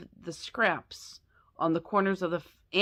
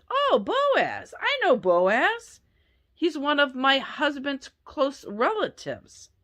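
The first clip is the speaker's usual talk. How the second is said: excited Naomi; 6 semitones higher, slower than usual. Acted. Naomi excitedly recognizes Boaz.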